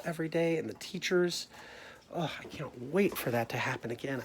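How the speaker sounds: ordinary talk, nothing marked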